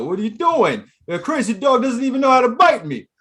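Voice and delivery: high, loud exasperated wiseguy; mock wiseguy griping. Cool Mike at the dog, high loud griping. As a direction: shout a mock complaint. Acting out failed dog stunt.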